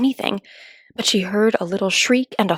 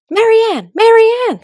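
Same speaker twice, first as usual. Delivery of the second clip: much higher, louder call from outside; calling out loudly; 14 semitones higher, 8 dB louder, slower than usual. Acted. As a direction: shout the name across the house.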